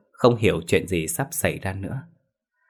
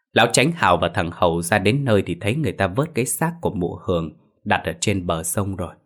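ordinary delivery, nothing marked

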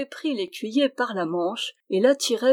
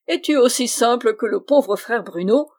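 louder, taunting cry; mocking exclamation; 3 semitones higher, 6 dB louder than usual. Dwarf teasing the hesitant squire, loud mock. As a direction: tease him with a laughing shout. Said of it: "s'écria-t-il".

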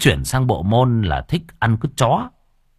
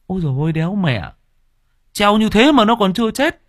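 shocked exclamation; 10 semitones higher, faster than usual. Retold or imitated. Villagers exclaim that the hanged addict still lives. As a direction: blurt out disbelief.

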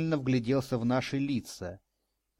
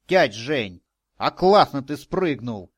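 put-on loud, excited boyish admiration; admiring exclamation; 8 dB louder, slower than usual. A narrator's reading. Boy praising his companion's jump, admiring exclamation. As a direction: exclaim it loudly, full of admiration. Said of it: "восхищённо воскликнул мой спутник".

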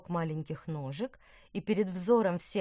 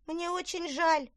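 meek high voice; 11 semitones higher than usual. Acted. Sleepy guard apologizes.

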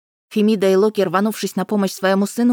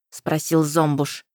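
ordinary delivery

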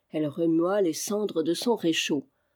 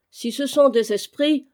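pressing on; 6 semitones higher, 6 dB louder than usual. A narrator's reading. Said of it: "continua la vieille".

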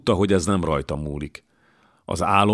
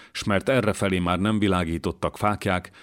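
usual speaking voice

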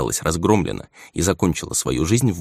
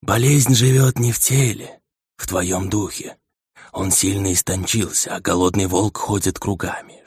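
slow spirit voice. Acted. The dead head speaks.

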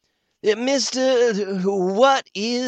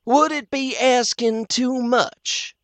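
higher, slow, overly polite; 13 semitones higher, slower than usual. Acted. The doctor asking the chairman, slow and very polite. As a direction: politeness with an edge. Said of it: "very polite".

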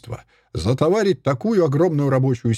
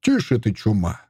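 ordinary delivery

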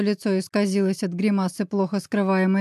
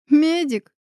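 higher, louder incredulous echo; scornful smirk; 7 semitones higher, 5 dB louder than usual. A narrator's reading. Woman scoffing at the medical-school guess, smirking. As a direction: scoff at the suggestion. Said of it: "усмехнулась Елена".

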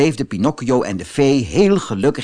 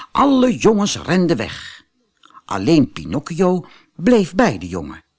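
usual speaking voice

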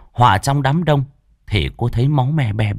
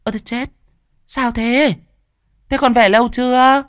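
flustered surprise. A narrator's reading. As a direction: blurt questions as if caught out. Stepmother caught out, rushes out asking. (giọng dì ghẻ hốt hoảng, cao vút)

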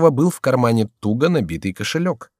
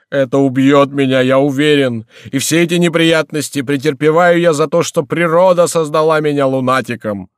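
high, loud self-pitying wail; plaintive character voice; wailing lament; 5 semitones higher, 6 dB louder than usual. A narrator's reading. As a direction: bemoan your fate at full voice. Gorenflot laments his suffering.

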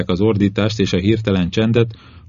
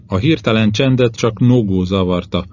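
ordinary delivery

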